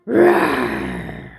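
low, rasping play roar; lion roar. Pretend. Carer showing Orion how the lion goes, playful roar. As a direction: give a pretend lion's roar. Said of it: "does he go".

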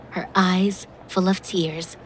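ordinary delivery, nothing marked

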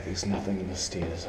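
ordinary talk

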